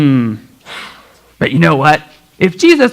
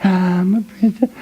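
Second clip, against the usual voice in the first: ordinary delivery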